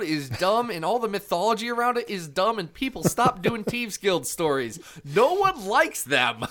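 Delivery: exasperated rant. Real, not acted. Ranting about a hated storyline, exasperated and high. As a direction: plead loudly for it to stop.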